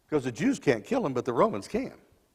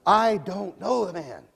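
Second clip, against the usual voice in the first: slow, strained denial, louder; cursing denial; 6 semitones higher, 4 dB louder, slower than usual. Acted. Peter curses himself before the crowd, slow and strained. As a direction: spit the curse out slowly. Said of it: "call down curses on himself".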